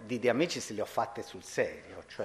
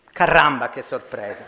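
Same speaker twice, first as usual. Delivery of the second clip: caramba blurted much louder; mock exclamation; 2 semitones higher, 12 dB louder than usual. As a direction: caramba shouted out in playful surprise. Mock exclamation after the story. (caramba gridato forte, finta sorpresa)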